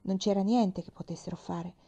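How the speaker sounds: plain reading voice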